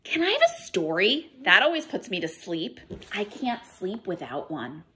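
lively storybook read-aloud with character voices; picture-book storytime voice; reading to children. Acted. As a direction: read it to a class of little ones. Teacher reads picture book aloud.

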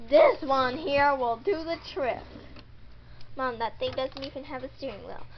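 usual speaking voice

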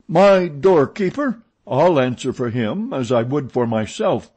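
high, loud indignant protest. A narrator's reading. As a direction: protest in offended disbelief. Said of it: "protested Mercier".

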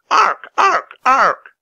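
very high, loud squawking caws; shrill raven caws. Acted. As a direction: attack cry of a diving raven. Teddy dive-bombing the sentries.

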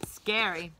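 ordinary delivery, nothing marked

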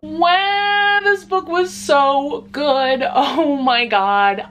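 high, loud, stretched out in awe; overwhelmed excitement. Real, not acted. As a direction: gush slowly, blown away. Finishing book, overwhelmed.

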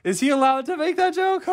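very high, squeaky-nervous aside with uneasy laugh; nervous amusement. Acted. Follower reacting to the Messiah's joke, mock nervous. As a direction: imitate someone unsure whether laughing is allowed. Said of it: "that nervous laugh everyone gives him".

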